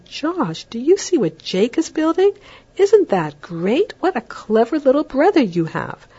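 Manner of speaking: put-on high, bright praise for a child; enthusiastic praise. Shown as a demonstration. Parent to five-year-old Josh about Jake, enthusiastic high praise. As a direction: sell it to the older brother with big enthusiasm.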